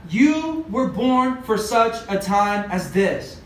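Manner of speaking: slow, word by word, weight on "you"; measured, spaced-out "for such a time as this"; emphatic declaration. Real, not acted. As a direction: land each word of "you were born" deliberately. Preacher turns the quote on listeners.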